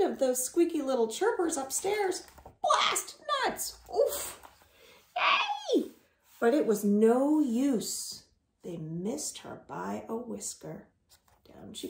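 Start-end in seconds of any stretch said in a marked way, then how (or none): 2.6-4.4 high squeaky frustrated squirrel; squirrel's curse. Acted. Squirrel's exclamation. 5.2-5.9 very high shout of hey; squeaky hey. Acted. Squirrel calling out. 8.6-11.9 unvoiced, softer whisper. A narrator's reading. Whispered falling narration.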